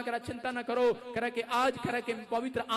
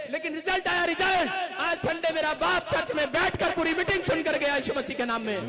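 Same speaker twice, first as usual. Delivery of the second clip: loud, higher preacher's shout; preaching; 5 semitones higher, 5 dB louder than usual. Acted. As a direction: proclaim the result to the church. Preaching at full force. (ज़ोरदार प्रचार)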